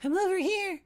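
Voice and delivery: very high, airy calling voice; distant beckoning voice. Acted. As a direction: call out sweetly from far away. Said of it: "the sweet beckoning voice".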